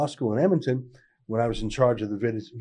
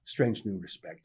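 dry sardonic aside; 4 semitones lower, 9 dB quieter than usual. Real, not acted. Sardonic refrain after example.

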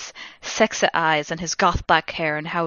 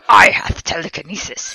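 loud, pompous villain declaration; cool villain; 5 dB louder than usual. A narrator's reading. Voldemort explaining himself to the heroine, cool pompous villain. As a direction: announce it grandly as a villain. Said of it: "he answered coolly".

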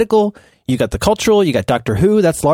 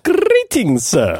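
shakespearean english; 3 semitones higher, much slower than usual. Pretend. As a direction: greet like a Renaissance fair courtier. Renaissance fair greeting.

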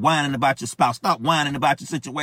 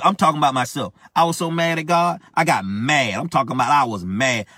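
ordinary talk, nothing marked

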